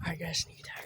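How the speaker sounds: hushed sneak-attack whisper. Real, not acted. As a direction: whisper it so they can't hear.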